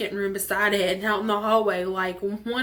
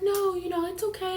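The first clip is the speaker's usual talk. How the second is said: soothing friend; 10 semitones higher than usual. Retold or imitated. Friend comforting her, high voice.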